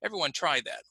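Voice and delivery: normal talking voice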